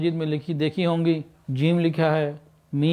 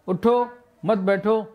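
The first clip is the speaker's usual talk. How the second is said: اٹھو raised, a short break before a rising بیٹھو; shifted pause; 3 semitones higher than usual. Shown as a demonstration. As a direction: lift اٹھو, pause, then finish on بیٹھو going up. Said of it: "تو سب چیزوں میں فرق آ جاتا ہے".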